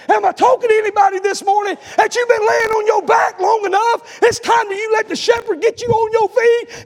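loud, high, shouting sermon climax; full force preaching. Acted. Preacher to congregation, shouting climax with whoop.

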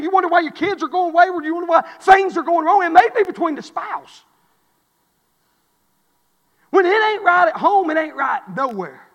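high, loud climax ending in a shout; preaching shout. Acted. Preacher driving home that home must be right, shouting. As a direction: cry it out to the rafters.

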